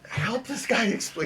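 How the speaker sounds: high, drawn-out exasperated plea; impatient pleading. Acted. Listener grabs at a companion, desperate for information, impatient pleading. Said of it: "he wants to take in as much information as fast as possible".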